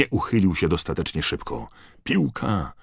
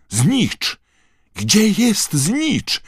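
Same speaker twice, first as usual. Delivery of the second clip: very high, loud, stretched cry; shouted question; 7 dB louder, much slower than usual. A narrator's reading. Charlie looks for the snitch, shouting. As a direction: shout high and long, searching urgently. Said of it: "krzyknął Charlie".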